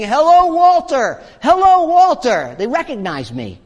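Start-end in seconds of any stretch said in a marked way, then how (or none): 0.0-2.6 high, loud hailing call; mock shout, pitched way up; imitated shouting. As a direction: call across the water as if greeting someone. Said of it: "waving and shouting at him".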